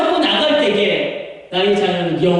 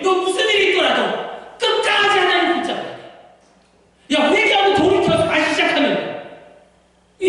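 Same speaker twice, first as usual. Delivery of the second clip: high, fervent fatherly exhortation; David; 9 semitones higher than usual. Acted. David urging Solomon to remember, impassioned plea. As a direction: plead with your son insistently.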